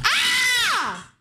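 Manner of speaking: sudden high-pitched scream; startled scream. Real, not acted. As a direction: shriek in surprise. Sudden scream, cat attacks.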